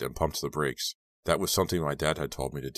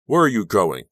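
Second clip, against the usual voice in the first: calling out loudly after her; shouting; 5 semitones higher, 10 dB louder than usual. A narrator's reading. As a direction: shout it after someone leaving. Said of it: "Robbie shouted after me".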